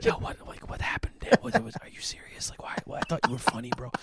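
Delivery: whispered, incredulous funeral questioning; hushed whisper. Acted. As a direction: whisper it like a tactless mourner. Tactless mourners questioning him at funeral.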